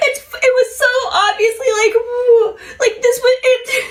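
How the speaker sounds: high-pitched, laughing through the words; can barely get it out for laughing; giggling so hard it almost sounds like crying. Real, not acted. Laughing retelling the fake kiss.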